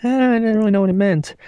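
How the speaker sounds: high, cartoony put-on voice; a squeaky toon imitation; cartoon voice. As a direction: pop into a silly cartoon character. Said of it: "and it's like".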